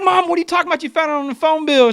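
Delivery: high-pitched retold panic; incredulous, voice pushed up high; freaking out. Son freaking out at mom's confession, high-pitched disbelief. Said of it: "So I'm freaking out".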